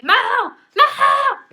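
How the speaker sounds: high, loud squeal of a name; overjoyed acted scream; excited scream. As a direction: shriek it in delight across the room. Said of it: "And then she screams. She's so excited".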